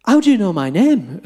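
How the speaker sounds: imitating an astonished lad, pitch swooping; mock amazement, lightly played; startled question. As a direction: act out a baffled demand. Acts out startled lad's question.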